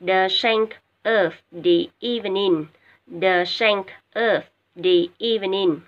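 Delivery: teacher modelling each word twice, loud and careful; a teacher drilling English vocabulary aloud; slow, loud model reading. Shown as a demonstration. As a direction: read out slowly for students to repeat. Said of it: "nghe cô đọc ha và lặp lại theo cô nha".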